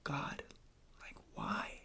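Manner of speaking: soft unvoiced whisper to God; hushed whispered lament; whispered despair. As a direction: breathe it out as a plea. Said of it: "the first thing I thought of".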